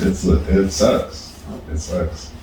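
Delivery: ordinary talk, nothing marked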